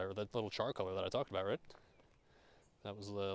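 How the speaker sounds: ordinary talk, nothing marked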